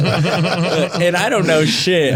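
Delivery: slow laughing admission. Real, not acted. Admitting to friends he knew nothing, laughing. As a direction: laugh through the line.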